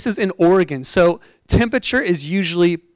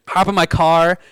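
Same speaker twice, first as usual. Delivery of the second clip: ordinary talk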